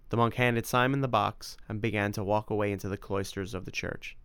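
usual speaking voice

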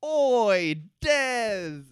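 high, loud holler with drawn-out vowels; mock shout. Soldier shouts after a fleeing Desmond, loud drawn-out yell. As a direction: yell it across a distance, stretching each word. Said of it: "the fellow shouts at him".

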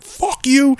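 high-pitched retold cursing; mock angry retort. Turning round on the man who challenged him, mock angry. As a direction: imitate yourself hurling it back, pitched high.

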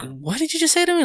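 high-pitched disbelief without extra volume; retold can't-believe-it question; stunned denial. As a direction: ask it incredulously, pitch leaping up. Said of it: "like, a state of denial".

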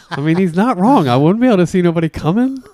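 normal talking voice

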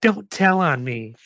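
put-on slow, high child's plea; lightly voiced pleading kid; little girl. A narrator's reading. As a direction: beg slowly in a girlish pitch.

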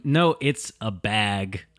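usual speaking voice

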